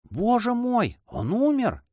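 high-pitched, dismayed question; shocked. A narrator's reading. Shocked at the injured monk. (потрясённо, высоким голосом)

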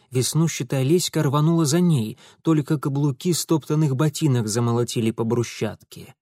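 plain reading voice

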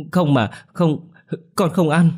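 put-on high, slow, frightened child's wail; crying refusal. Little girl refusing the rice, frightened wail. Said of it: "khóc ré lên".

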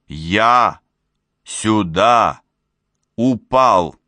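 громко, с паузой после каждого слова; чеканить каждое слово, убеждая; настойчивое убеждение. A narrator's reading. Убеждает недоверчивую Бурю в невероятном. (раздельно и с нажимом)

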